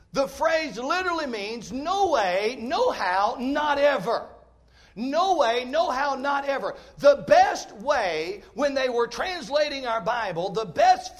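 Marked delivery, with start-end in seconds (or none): none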